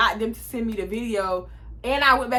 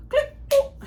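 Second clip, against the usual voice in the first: high squeaky click boop; hang-up noise. Retold or imitated. Hanging up on the boyfriend with a squeaky click boop. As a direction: make the phone hang-up sound.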